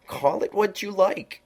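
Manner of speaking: high, slow, drawn-out cat voice; lofty knowing cat, high and slow; Cheshire Cat voice. A narrator's reading. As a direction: purr it out like a sly grinning cat.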